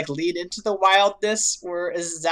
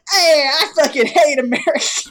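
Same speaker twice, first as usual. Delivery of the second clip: higher, louder mock Brooklyn tough guy; Joey's Brooklyn accent; 6 semitones higher, 5 dB louder than usual. Joey snapping at the American duelist, mock Brooklyn accent. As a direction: punch it out in a hammed-up Brooklyn accent. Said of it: "I can't even do it".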